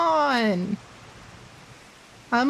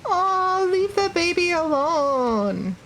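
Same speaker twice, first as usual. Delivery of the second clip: cooing aw; 7 semitones higher, slower than usual. Real, not acted. Streamer coos protectively at the cute baby shark.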